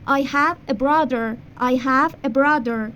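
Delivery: slow teacher demo of the sentence; slow model sentence. Shown as a demonstration. Teacher modelling English for Persian students, slow. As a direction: say it slowly for repetition. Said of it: "مثلاً".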